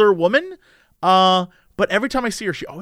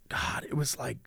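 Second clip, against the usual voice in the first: whisper; 7 semitones lower, 16 dB quieter than usual. Real, not acted. Whispered aside while recalling.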